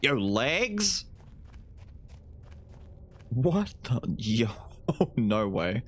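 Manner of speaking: normal talking voice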